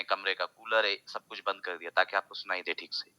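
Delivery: normal talking voice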